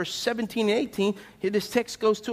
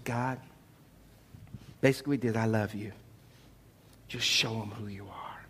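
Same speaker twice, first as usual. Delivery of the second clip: low, soft prayer sinking to a whisper; hushed prayer; 11 semitones lower, 8 dB quieter than usual. Acted. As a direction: drop right down, intimate, as if to God. Elijah's quiet prayer to God.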